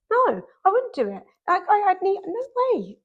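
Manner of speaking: high, emphatic no way; rising alarm, retold in a high voice; emphatic refusal. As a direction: refuse it hard, pitch jumping up. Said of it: "And I was like".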